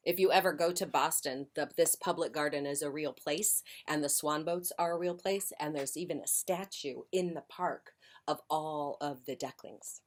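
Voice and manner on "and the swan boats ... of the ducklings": usual speaking voice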